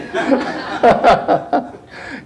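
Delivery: high unvoiced giggles; real amused giggling; giggling fit. Real, not acted. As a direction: let the laughter roll. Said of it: "I'm channeling Bugs Bunny".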